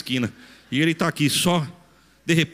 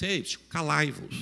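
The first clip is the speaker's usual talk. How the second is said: soft, slow formal hushing; a mock-proper request for silence; over-polite etiquette; 6 dB quieter than usual. Shown as a demonstration. Parent hushing a child too formally, mock-polite. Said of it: "É muita etiqueta".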